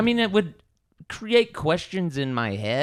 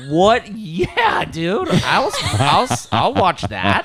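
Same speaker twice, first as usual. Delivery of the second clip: loud, high eager yes; excited; 5 semitones higher, 7 dB louder, much slower than usual. Pretend. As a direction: cheer it on loudly. Imagined eager husband reply.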